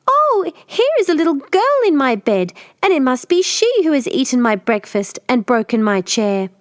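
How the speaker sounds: very high squeak of a cub; squeaky tiny bear. Acted. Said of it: "Tiny Bear squeaked out".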